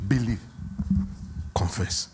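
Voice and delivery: "Ask", "believe", "confess", the last one whispered; fading list. Real, not acted. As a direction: let the formula die away. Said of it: "reduce it to formula".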